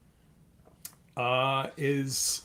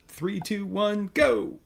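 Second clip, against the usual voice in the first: countdown call; 7 semitones higher, 4 dB louder, slower than usual. Acted. Countdown before launching.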